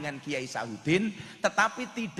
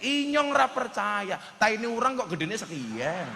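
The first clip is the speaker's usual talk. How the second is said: yelling; 6 semitones higher than usual. Retold or imitated. Tegal man yelling in disbelief at the Sundanese man. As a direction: cry out in disbelief. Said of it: "langsung teriak-teriak".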